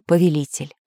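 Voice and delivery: ordinary delivery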